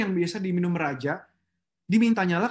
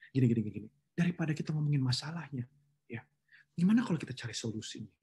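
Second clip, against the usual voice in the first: lower, gentle suggestion; calm peacemaker; 6 semitones lower, 6 dB quieter, faster than usual. Shown as a demonstration. Peacemaker calms coworkers who complain. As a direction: steer them softly to solutions.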